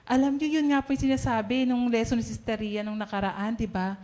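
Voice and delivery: normal talking voice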